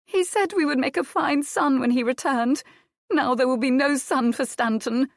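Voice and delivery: high, loud and grieving; tearful. Acted. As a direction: plead through tears. Adair weeping continues.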